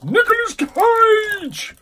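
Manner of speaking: sudden high, loud yell; exasperated scream. Real, not acted. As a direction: shriek the name in exasperation. Exasperated, reading the name on case.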